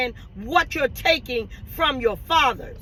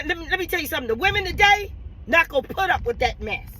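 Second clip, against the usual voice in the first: ordinary talk, nothing marked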